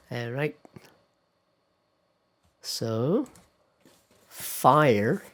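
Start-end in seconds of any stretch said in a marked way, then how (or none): 4.3-5.2 American "fire" with the R pronounced. Shown as a demonstration. Teacher labelling the American "fire" with the R.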